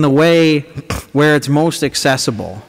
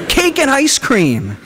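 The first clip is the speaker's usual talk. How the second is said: excited blurt; 11 semitones higher, slower than usual. Acted. Sudden high excited answer.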